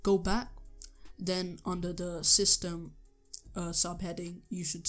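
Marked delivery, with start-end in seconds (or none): none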